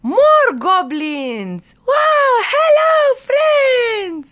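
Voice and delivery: very high, loud squeal of delight; elated high-pitched shout; joyful cheering. Acted. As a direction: shout a thrilled greeting to new friends. Joyful reveal of more goblins.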